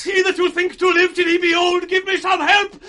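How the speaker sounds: panicked shouting plea; desperate plea. Acted. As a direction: scream for rescue. Gloucester begs while blinded.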